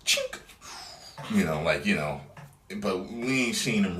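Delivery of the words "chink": mock gun sound. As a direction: imitate the grapple gun click. Imitating bat gun sound.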